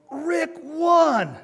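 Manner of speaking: high, loud drawn-out holler; celebratory yell. Retold or imitated. Buddy announces win to silent crowd, high yell. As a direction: yell the result to a stunned room. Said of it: "My buddy yelled".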